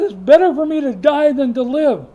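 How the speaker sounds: Jonah wails loud and high, wanting to die; despairing cry. Acted. Jonah begging God to let him die, loud wail. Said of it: "He says".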